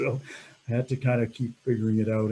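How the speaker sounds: usual speaking voice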